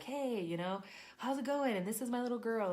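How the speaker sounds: high, bright friendly greeting; cheerful greeting. Retold or imitated.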